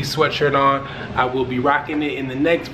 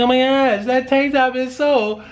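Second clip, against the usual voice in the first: swooning fan; 9 semitones higher than usual. Retold or imitated. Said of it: "they be like".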